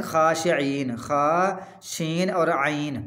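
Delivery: खाशिईन drawn out, then its letters खा, शीन, आइन one by one; slow teaching of खाशिईन letter by letter; spelled out slowly. Shown as a demonstration. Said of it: "एक होता है खा".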